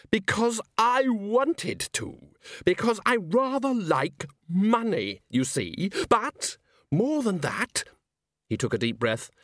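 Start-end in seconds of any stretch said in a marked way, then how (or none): 0.1-7.8 high, slow theatrical confession; gloating villain. Acted. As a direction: draw out each phrase for the audience. Salzella acts out villain's confession.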